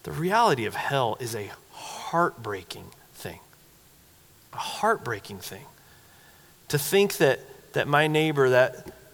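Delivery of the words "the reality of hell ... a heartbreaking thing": low, soft, near-whispered solemnity; quiet, sorrowful weight; hushed and grieved. Real, not acted. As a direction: drop to a grave hush, pausing between phrases. Solemn turn after the rebuke.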